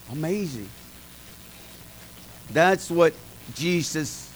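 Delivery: ordinary delivery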